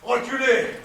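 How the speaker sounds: loud sharp order; commanding shout. Acted. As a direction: order them back loudly. Commanding crowd to back away.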